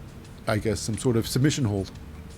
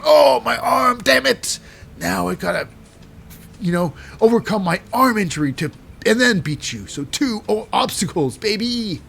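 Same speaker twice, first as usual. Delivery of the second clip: hammed-up injured wrestler; 7 semitones higher than usual. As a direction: play up the fake injury like a heel. Wrestler voiced mid-match.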